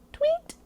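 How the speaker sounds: very high chirp; bird tweet. A narrator's reading. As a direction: imitate a little bird with one rising peep. Imitates birdsong in the story.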